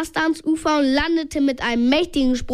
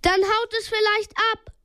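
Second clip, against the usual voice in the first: calling out; 8 semitones higher than usual. A narrator's reading. Justus hoping to drive the creature off, calling out. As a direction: pitch it up as a call to friends. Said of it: "rief Justus".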